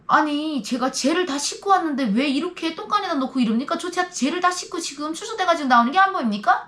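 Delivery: high, loud indignant protest; tearful protest. Retold or imitated. Released prisoner protesting to guards, high and loud. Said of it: "내가 울면서 막 얘기했어요".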